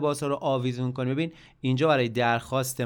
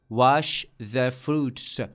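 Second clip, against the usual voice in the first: slow model repeat; much slower than usual. Shown as a demonstration. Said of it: "میوه ها رو بشور".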